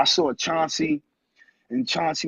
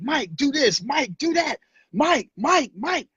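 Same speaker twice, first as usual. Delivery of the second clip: mock coach calling; 8 semitones higher, slower than usual. Coach calling his name repeatedly.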